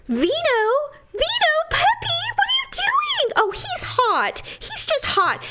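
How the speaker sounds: put-on very high-pitched baby talk to a puppy; mimicked doting dog owner. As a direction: coo and fuss over the dog in a squeaky imitation. Dog owner fussing, acted out.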